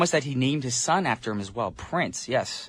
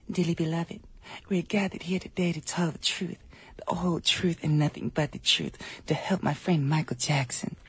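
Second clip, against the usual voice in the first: soft, higher, put-on [name] voice; imitation of [name]; 3 semitones higher, 7 dB quieter than usual. Radio host imitating [name] at the witness stand, soft and high. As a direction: hush it and float it up like [name]. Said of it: "that's my imitation of [name]".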